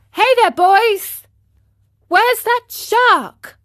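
put-on high, loud call to the boys. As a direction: shout it out as a lively question. Calling out to his playmates.

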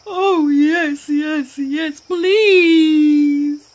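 high, wailing plea; desperate tearful hippo. A narrator's reading. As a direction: beg loudly, drawing out the last word. Suffering Hippo begs for help.

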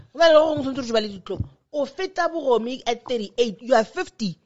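fast, loud, indignant scolding; outraged rant. Real, not acted. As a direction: pile on the outrage quickly. Outraged rant about age gap.

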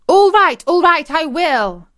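high, loud panicked shriek; frantic, high-pitched giving in. A narrator's reading. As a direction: shriek in surrender. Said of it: "shrieked Agrippina from behind the sofa".

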